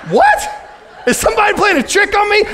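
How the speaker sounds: squeaky high outraged what; stranded on a bridge, stunned by the GPS; shocked disbelief. Retold or imitated. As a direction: jump up in pitch in total disbelief.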